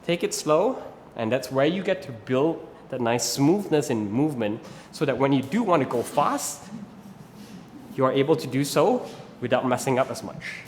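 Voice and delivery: usual speaking voice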